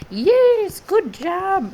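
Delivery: put-on high, crackly little old lady voice. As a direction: praise them like a sweet little old lady. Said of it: "I was trying to sound like a little old lady".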